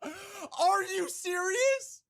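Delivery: high, slow, half-crying disbelief; stunned streamer whining at a failed upgrade; shocked disbelief. Real, not acted. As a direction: wail it like you can't believe it failed. Enhancement fails on stream.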